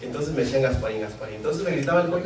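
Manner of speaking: ordinary delivery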